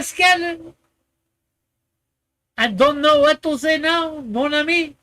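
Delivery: high, hammed-up Frenchman; fake French accent. Running out of things to say, mock French accent. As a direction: exclaim in a put-on French accent. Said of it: "I could put on, eh, the accent".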